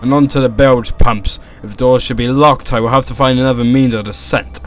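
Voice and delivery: loud, resolute story narration; dramatic reading. Acted. Reading the game's text aloud, in dramatic narration. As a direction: read it out with theatrical resolve.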